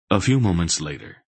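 usual speaking voice

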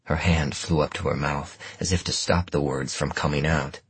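usual speaking voice

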